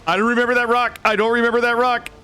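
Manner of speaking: hope rising at every repeat; high, eager, half-disbelieving chant; excited disbelief. Real, not acted. Spots unfamiliar rock, hoping.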